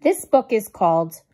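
loud. Real, not acted. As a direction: announce the title with flair. Announcing book title.

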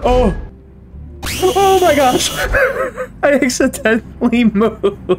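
high shriek breaking into laughter; yelp, then laugh through the words; giggling at own slip-up; startled laughter. Real, not acted. Caught moving in red light game.

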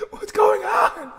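high laughing wail; mock crying. Streamer jokes with crying filter, high laughing wail. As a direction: squeal it like a fake sob.

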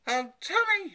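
high, loud cry from a shocked mother; horrified cry. A narrator's reading. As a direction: cry out in high-pitched dismay. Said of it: "she cried".

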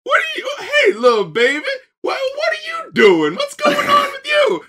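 high-pitched mock mom fussing at a baby; mom from a skit. Co-host imitates a skit mom questioning a baby, high mock fussing. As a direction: squeal the questions in a mocking falsetto. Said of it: "the mom from Sister, Sister".

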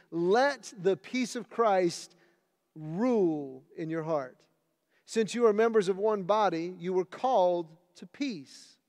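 loud, measured Bible reading; scripture reading. Acted. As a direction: proclaim each verse to the church. Reads second scripture passage.

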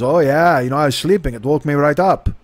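lightly imitated German accent; a hint of a casual German speaker; German-accented neighbor. Neighbor chats about being woken up, light German accent. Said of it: "he sounds like he's German".

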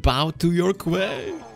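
slow, drawn-out command climbing high; grand mock queen. Player lording it over the opponent, grand mock-queen voice. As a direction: stretch it out imperiously, pitch rising to the end. Repeats the game's line grandly.

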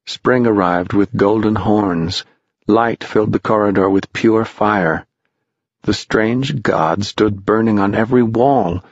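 plain reading voice

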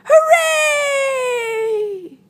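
high, long drawn-out cheers; cheering. Acted. Trapped animals rescued, high joyful cheer. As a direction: whoop and hold the last vowel.

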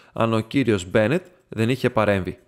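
ordinary delivery, nothing marked

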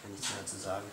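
frustrated whisper; exasperated breathy hush. Real, not acted.

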